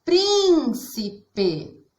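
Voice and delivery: príncipe with a high, stretched PRIN, then stepping down; stress on PRIN. Shown as a demonstration. Teacher repeats the example for pupils, first syllable of príncipe stressed. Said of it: "nós falamos mais forte".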